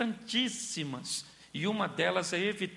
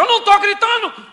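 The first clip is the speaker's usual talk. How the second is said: much higher and louder, yelling that you aren't yelling; mock shout; 18 dB louder than usual. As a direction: shout the denial ironically. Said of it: "Você diz".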